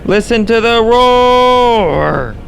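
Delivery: high, slow, stretched-out shout of awe; awed excited shout. Real, not acted. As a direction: call it out over the roar. Large wedge tornado roaring.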